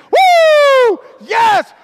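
ecstatic high shouts of praise; screaming praise. Retold or imitated. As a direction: shout it out like a wild church fan. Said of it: "He's screaming at the top of his lungs".

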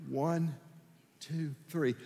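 soft, slow count to work up courage; tentative under-the-breath countdown; hesitant counting. Retold or imitated. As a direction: count quietly, as if afraid to make noise. Child nervously counting before calling Dad.

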